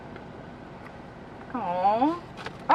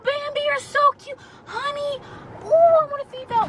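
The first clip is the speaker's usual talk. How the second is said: sweet, much higher coo; 12 semitones higher than usual. Real, not acted. Cooing at the fawn Bambi, high and sweet.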